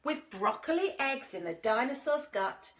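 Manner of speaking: plain reading voice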